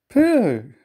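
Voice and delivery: high, loud, drawn-out "pooh"; disgusted exclamation. Acted. Thomas to the others about a smell, disgusted.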